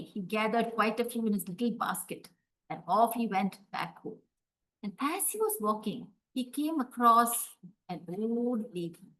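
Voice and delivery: usual speaking voice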